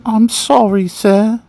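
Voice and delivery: put-on high little-boy English accent; proper English accent, pitched high; silly English accent. A narrator's reading. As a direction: apologise as a very proper English child. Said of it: "in that silly sounding English accent".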